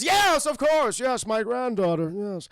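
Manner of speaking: loud, high grandpa act; hammed-up eager old-man agreement; Aang as old man. Fake grandfather agreeing with the guard, loud and overeager. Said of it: "He goes".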